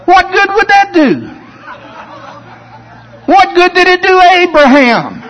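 high scornful mock question. Preacher mimicking a scornful church member, high sneering voice. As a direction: sneer it like a doubter. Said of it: "the way he said it was like".

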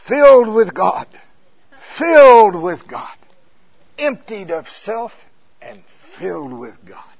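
put-on loud Welsh-Cockney preacher accent; Smith Wigglesworth. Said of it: "real Welsh accent, a Cockney accent thing".

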